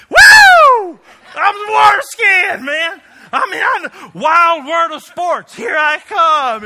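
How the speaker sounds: whooping like a thrill-crazed beginner; overexcited novice skier. Retold or imitated. Preacher imitating a novice skier shouting with glee. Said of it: "but they're like".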